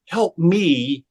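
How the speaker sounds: a hint of Tom Cruise pleading; quoting the famous movie scene, lightly. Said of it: "where Tom Cruise was looking up at Cuba Gooding".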